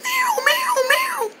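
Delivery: very high, soft wail; faint bird cry. Acted. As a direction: whimper like a hurt bird. Imitates weakened bird cry.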